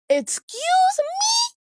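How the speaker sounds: very high, slow, drawn-out "Excuse me?"; a squeaky, outraged "Excuse me?" at the little brother; indignant "excuse me". Retold or imitated. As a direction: stretch it out in shocked disbelief. Tripped by brother.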